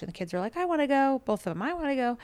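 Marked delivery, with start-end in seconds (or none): 0.6-1.2 put-on kids begging. 1.6-2.2 put-on kids begging. Both kids begging again.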